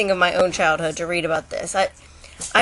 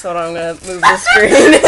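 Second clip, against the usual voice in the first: laughing; 9 semitones higher, 7 dB louder than usual. Real, not acted. Sister's silly skirt.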